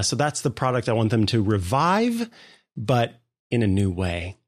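ordinary talk

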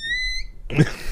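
very high, thin imitated meow; wild mock cat meow. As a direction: yowl like a newborn-birthing cat. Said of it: "Yeah, it was pretty good".

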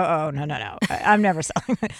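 ordinary talk

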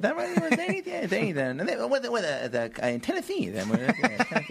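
hammed-up late-night host, high and eager; Jay Leno impression. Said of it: "Hey, Jay Leno".

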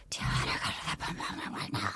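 breathy whispered invitation; mock seductive whisper to Kenny; sultry whisper. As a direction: whisper it low and suggestive. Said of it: "And then Demi goes".

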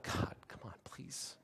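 hushed, voiceless begging; whispered plea. Acted. Struggling believer reaching out to God in pain, whispered plea. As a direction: plead under your breath, barely audible.

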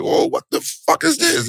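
a stunned yelp at a tiny paycheck; shocked outburst. Retold or imitated. Fighter looking at his check, high shocked disbelief. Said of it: "I'm like".

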